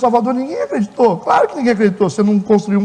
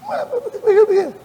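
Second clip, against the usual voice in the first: mock skeptic; 11 semitones higher than usual. Pastor voices skeptic questioning church.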